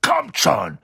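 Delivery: short-tempered command; angry. A narrator's reading. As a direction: order them along crossly. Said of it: "sagte Hagrid zornig".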